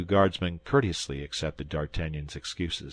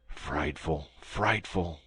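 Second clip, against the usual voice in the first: softer murmur of horror; 3 semitones lower, 5 dB quieter, much slower than usual. A narrator's reading. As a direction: murmur it under your breath. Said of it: "murmured Athos".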